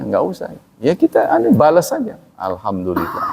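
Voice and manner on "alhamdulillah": low, soft acted response; unbothered reply. Preacher modelling a reply to odd remarks, calm and soft. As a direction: say it quietly and low, taking no offence.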